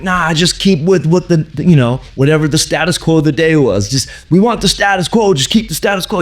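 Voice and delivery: high-voiced mock dismissal; naysaying bosses. Artist mimicking publishers refusing new ideas, high dismissive voice. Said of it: "And they were like".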